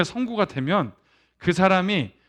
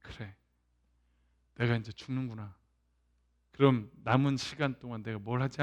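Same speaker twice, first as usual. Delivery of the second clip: resigned acceptance; 6 semitones lower, 8 dB quieter than usual. Retold or imitated. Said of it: "받아들이는 거예요".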